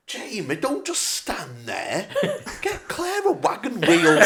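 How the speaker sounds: rising high, slow, laughing mum voice; imitating mum. Son imitating his mum greeting his girlfriend, high. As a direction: nag it slowly, climbing. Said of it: "And she went".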